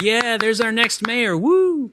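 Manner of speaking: high, slow, cheering like a crowd member; hammed-up crowd whoop "Woo!"; mock cheering crowd.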